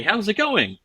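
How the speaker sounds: mock-casual, high-pitched opener; acted high, over-friendly greeting; awkward greeting.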